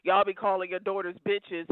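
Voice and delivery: normal talking voice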